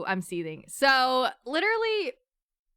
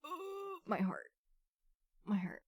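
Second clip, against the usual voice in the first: heartbroken; 6 semitones higher, 13 dB quieter than usual. Real, not acted. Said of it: "I was crying".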